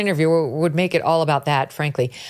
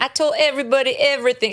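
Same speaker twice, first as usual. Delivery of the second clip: imitating her street accent, higher and fast; mock street accent; 7 semitones higher, faster than usual. Host mocking Michelle's street accent on the podcast. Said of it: "her street accent".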